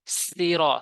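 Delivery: normal talking voice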